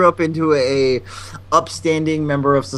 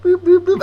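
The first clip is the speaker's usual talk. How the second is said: high-pitched little boop noises; imitated boops. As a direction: imitate a jiggle with squeaky boops. Imitates jiggling the deadbolt open.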